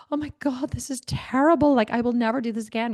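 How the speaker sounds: mock remorseful husband. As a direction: play the sorry husband, a bit overdone. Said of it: "have him go like".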